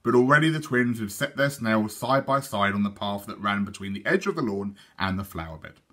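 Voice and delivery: plain reading voice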